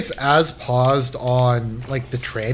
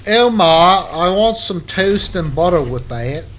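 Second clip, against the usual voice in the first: loud, higher-pitched bad British accent; attempted Cockney accent; 5 semitones higher, 5 dB louder than usual. Asking for toast and butter, badly faked Cockney. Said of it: "it's like a Cockney accent".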